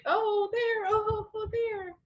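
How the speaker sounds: high-pitched darting oohs; light, high little exclamations; mock wonder. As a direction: act out spotting place after place, high. Acting out mind jumping places.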